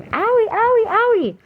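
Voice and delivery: put-on very high, loud child's wailing owie; imitating toddler's scream. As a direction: cry out like a hurting little girl. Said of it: "she would constantly scream".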